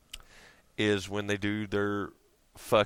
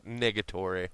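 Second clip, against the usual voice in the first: ordinary delivery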